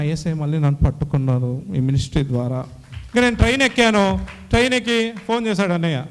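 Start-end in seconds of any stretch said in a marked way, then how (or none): none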